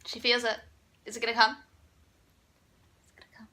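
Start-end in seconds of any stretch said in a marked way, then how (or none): 3.0-3.5 breathless whisper, almost silent. Real, not acted. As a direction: hush it right down. Waiting for a burp, whispering.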